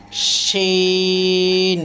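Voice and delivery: Sheen held very long on a level tone; three-alif stretch. Shown as a demonstration. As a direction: draw out the "ee" of শিন for three counts. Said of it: "এটাও তিন আলিফ".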